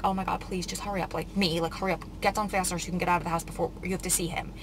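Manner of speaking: quiet, fast urging to herself under her breath; rushed hushed self-talk; hushed hurry. Retold or imitated. Hurried self-talk while getting ready.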